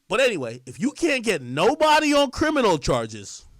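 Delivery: ordinary delivery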